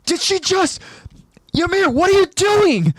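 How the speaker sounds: high-pitched disbelieving cry; stunned. A narrator's reading. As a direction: break into a shocked shout. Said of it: "A stunned Connie says".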